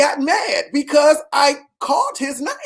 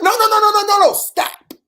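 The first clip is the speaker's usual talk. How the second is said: higher, louder rapid no's; firm cutoff; 9 semitones higher, 3 dB louder than usual. Retold or imitated. Father cutting off his son's excuse, high and forceful. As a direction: shut the excuse down fast.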